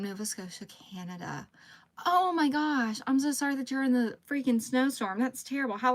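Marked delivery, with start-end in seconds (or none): none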